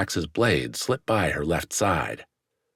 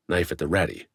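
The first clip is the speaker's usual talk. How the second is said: plain reading voice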